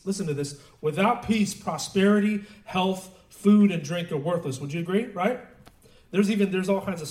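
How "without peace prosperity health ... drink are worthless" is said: loud, raised emphatic listing; declaimed quotation. Retold or imitated. Preacher driving home a rabbi's warning, loud declaimed quotation. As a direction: punch out each item like a preacher.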